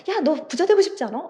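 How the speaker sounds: eager persuading. Acted. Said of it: "열심히 설득하는 거죠".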